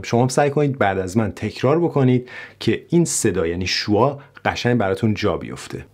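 ordinary talk, nothing marked

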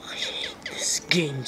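unvoiced, defeated whisper. Real, not acted. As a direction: breathe it out, beaten. Defeated gamer muttering.

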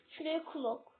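ordinary talk, nothing marked

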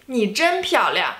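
ordinary talk, nothing marked

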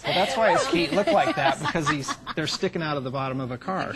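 laughing while explaining; words shaken with laughter. Real, not acted. Laughing at Fred Flintstone joke.

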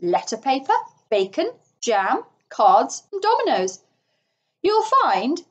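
proudly listing, lilting up high; mildly crowing show-off; triumphant. A narrator's reading. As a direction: reel off the treasures with pride. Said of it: "said the Toad triumphantly".

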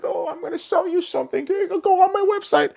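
high mock girly sales voice; mocking Instagram model. Mocking an influencer's sales pitch, high girly voice.

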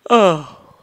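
loud real yawn. Real, not acted. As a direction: stretch out a big yawn. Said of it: "Sorry for me yawning there".

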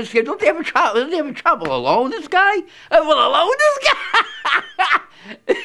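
high, disbelieving mock question; incredulous. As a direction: sound baffled in a high voice. Speaker's incredulous retort.

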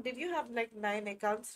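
ordinary talk

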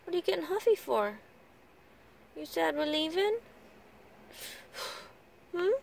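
high coaxing voice to a sulking pet. Real, not acted. Owner coaxing her sulking pet after swimming, high sing-song baby talk.

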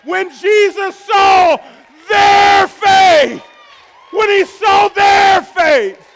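slow, high, booming preacher's cry; shouted preaching. Acted. Pastor shouting the verse to his church, slow and booming. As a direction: drag out each word like a revival shout.

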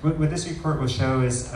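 normal talking voice

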